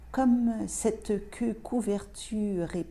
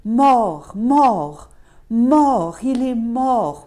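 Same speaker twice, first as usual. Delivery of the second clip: loud, higher-pitched cry, each word falling; cri de douleur; 4 semitones higher, 11 dB louder, much slower than usual. A narrator's reading. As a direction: cry it out like a memory screaming the news. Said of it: "ma mémoire a crié". (cri fort, plus aigu, qui retombe à chaque mot)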